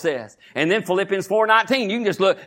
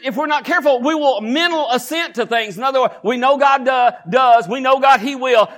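ordinary talk, nothing marked

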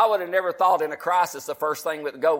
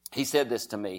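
usual speaking voice

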